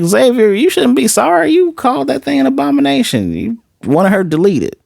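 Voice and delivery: high-pitched, incredulous telling-off; indignant scolding. Retold or imitated. Reader telling Xavier off for being sorry, indignant. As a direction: scold him up high, calling out the hypocrisy.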